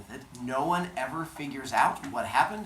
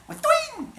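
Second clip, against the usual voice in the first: springy boing; 6 dB louder than usual. Acted. Athena springs out of skull.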